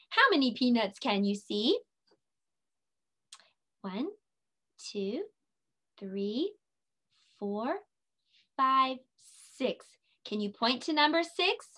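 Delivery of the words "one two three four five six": quiet counting. Shown as a demonstration.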